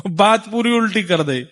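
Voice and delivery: usual speaking voice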